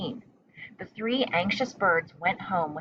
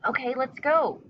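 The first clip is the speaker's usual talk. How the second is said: eager; 6 semitones higher than usual. A narrator's reading. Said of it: "agreed Pip".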